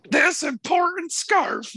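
high, slow, self-important drawl; affected important voice. Retold or imitated. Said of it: "voice, right?".

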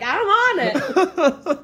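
high, loud, drawn-out mock-eager answer; eager reply. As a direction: pipe up like an over-keen assistant. Acted eager reply to Lipton.

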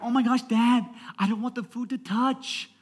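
high, whining imitation of a fussy kid; picky child. Daughter protesting to dad about food touching, picky child. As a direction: complain like a six-year-old.

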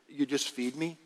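normal talking voice

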